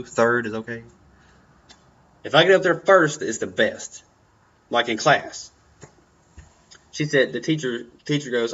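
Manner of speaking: ordinary delivery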